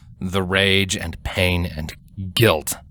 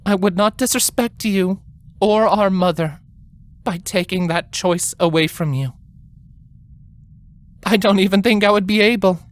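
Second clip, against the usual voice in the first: higher, strained voice holding back tears; tearful older sister; 11 semitones higher than usual. A narrator's reading. Sister begs Twilight to rest, holding back tears. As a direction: plead gently through welling tears. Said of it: "Tears were starting to well up in her eyes".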